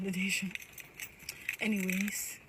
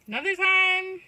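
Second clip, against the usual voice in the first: loud new start; 9 semitones higher, 10 dB louder than usual. Real, not acted.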